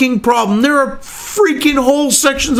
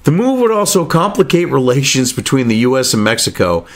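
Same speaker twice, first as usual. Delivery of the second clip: lower, measured reading-aloud voice; news article read; 8 semitones lower than usual. Acted. Reading the BBC piece aloud to viewers, measured read.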